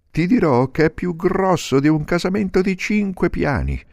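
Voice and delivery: molto alto; delfino. Acted. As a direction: descrivi con voce acutissima. Il delfino descrive. (delfino dalla voce acutissima)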